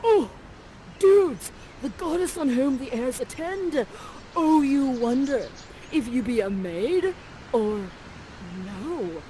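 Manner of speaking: a hint of a dopey surfer prince; mock macho prince. Ferdinand gazing at Miranda, lightly hammed dude voice. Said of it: "and he says".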